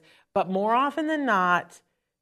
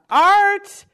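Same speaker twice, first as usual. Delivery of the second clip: calling out; 13 semitones higher, 11 dB louder than usual. Real, not acted. Greeting guest who pops in.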